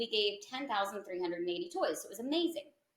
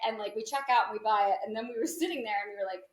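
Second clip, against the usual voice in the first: normal talking voice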